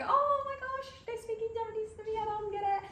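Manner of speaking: high, flustered mock panic; a squeaky hammed-up panic; panicked tourist. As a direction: play the lost traveller caught off guard. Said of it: "and then you're like".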